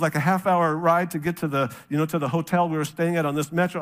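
usual speaking voice

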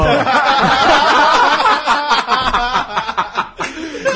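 bursting out laughing; loud laughing fit; long loud laugh. Real, not acted. Big laugh after punchline.

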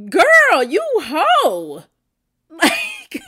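high, loud outraged call-out; outraged shout. Real, not acted. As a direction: yell it, scandalised. Outraged at the confession.

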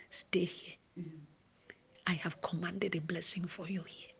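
the Spirit whispering close; whispered Holy Spirit. Acted. Holy Spirit quoted softly.

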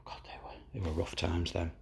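rueful whisper. Real, not acted. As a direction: breathe it out, weary and rueful. Rueful whisper after bad memories.

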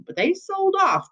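leaps high in disbelief; an incredulous old lawman; surprised. Acted. Constable hearing the land was sold, incredulous.

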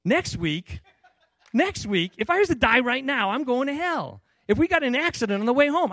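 high, loud, insistent objection; adamant protest. Retold or imitated. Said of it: "like, adamant about this".

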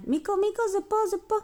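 high-pitched, held-back calling; small urgent call. Acted. Tomi signalling Miko about the box, small urgent call. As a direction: call him over in a high little voice.

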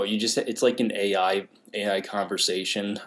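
usual speaking voice

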